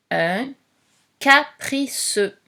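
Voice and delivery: slow dictation. Shown as a demonstration. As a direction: stretch capriceux out for a pupil writing. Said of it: "dictating word to pupil".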